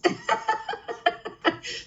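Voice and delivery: very high, soft giggle. Real, not acted. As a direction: giggle at her own squeaky voice. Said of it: "I'm using my little tired hedgehog voice".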